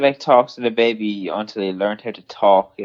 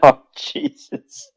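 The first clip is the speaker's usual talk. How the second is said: exasperated; much slower than usual. Real, not acted. Reacts to a shocking joke.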